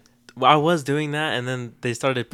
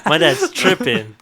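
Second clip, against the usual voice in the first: louder, higher, drawn-out incredulity; mock disbelief; 4 semitones higher, 9 dB louder than usual. Son retelling his first taste of dad's coffee, mock disbelief. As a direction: slowly exclaim it in comic disbelief.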